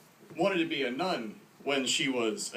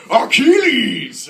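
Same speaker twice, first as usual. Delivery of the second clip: huge drawn-out theatrical bellow; booming epic-movie shout; Brian Cox; 10 semitones higher, 15 dB louder, much slower than usual. Acted. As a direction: bellow the name high and loud, stretching it. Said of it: "Brian Cox is like".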